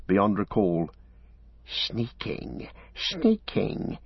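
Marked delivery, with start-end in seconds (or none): 1.6-4.0 hissing Gollum. A narrator's reading. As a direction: hiss it like a wounded creature. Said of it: "he hissed".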